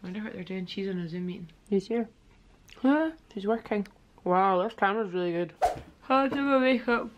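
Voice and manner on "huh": higher, louder puzzled huh; a sudden puzzled huh; surprised huh. Real, not acted. Reacting to people outside.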